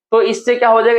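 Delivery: usual speaking voice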